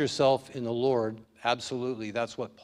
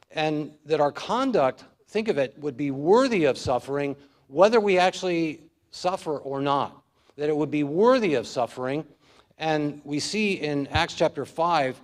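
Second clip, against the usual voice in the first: louder, higher preaching push; forceful preaching; 3 semitones higher, 6 dB louder than usual. Real, not acted. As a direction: drive the point home from the pulpit. Preaching builds to full force.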